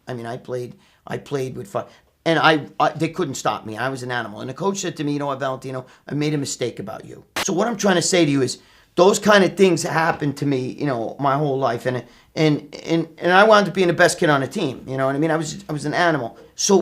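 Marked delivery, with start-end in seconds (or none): none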